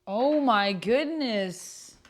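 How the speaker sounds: slow, loud, drawn-out oh my goodness; stunned exclamation. Real, not acted. Streamer reacting to a spicy story line, loud and drawn out. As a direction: let it stretch out in disbelief.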